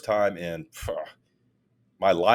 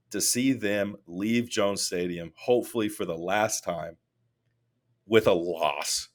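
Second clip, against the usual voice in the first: usual speaking voice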